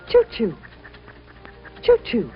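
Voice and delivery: ordinary delivery, nothing marked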